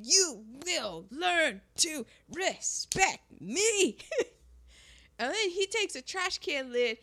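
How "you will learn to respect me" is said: slow, one word per blow; mock beating rhythm, very slow with gaps; punched-out words. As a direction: hammer out each word separately. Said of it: "just wailing, saying".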